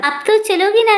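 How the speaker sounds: high, eager and coaxing. A narrator's reading. Husband coaxing his wife to come out, eager and coaxing. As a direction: coax her playfully, pitched well up.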